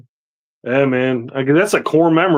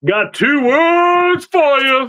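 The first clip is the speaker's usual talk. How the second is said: wrestling catchphrase; 11 semitones higher, much slower than usual. Retold or imitated. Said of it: "DX catchphrase joined in".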